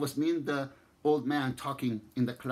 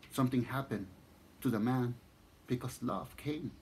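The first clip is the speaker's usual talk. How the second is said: usual speaking voice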